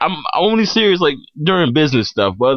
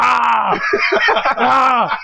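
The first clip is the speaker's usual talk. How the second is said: mock pained yelp; 8 semitones higher than usual. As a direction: yelp as if your finger's trapped. Finger caught in trunk.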